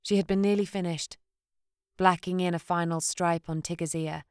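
plain reading voice

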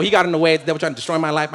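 ordinary talk, nothing marked

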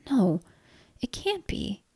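whispered, halting denial; stunned whisper, lifting high; disbelieving whisper. Acted. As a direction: whisper it as the truth sinks in. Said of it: "she whispered".

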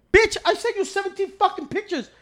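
high, loud indignant comeback; outraged retort. Acted. Angry reply to customer service email.

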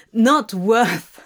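loud scornful echo and laugh; demon's sarcastic laugh. A narrator's reading. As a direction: throw his words back with a nasty laugh. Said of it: "nastily sarcastic laugh".

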